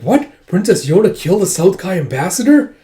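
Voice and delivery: astonished, pitch lifted; shocked disbelief. Acted. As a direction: act stunned by the accusation. Acted reaction to shocking accusation.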